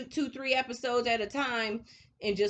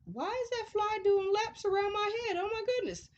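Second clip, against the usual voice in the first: much higher, exasperated at the fly; exasperated aside; 12 semitones higher than usual. Real, not acted. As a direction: swat the fly in annoyance. Annoyed by fly.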